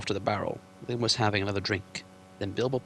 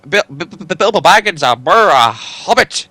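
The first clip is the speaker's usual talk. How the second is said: stammering, higher and louder in panic; shaking with fright; 8 semitones higher, 16 dB louder than usual. Acted. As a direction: stutter out your name in terror. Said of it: "shaking all over".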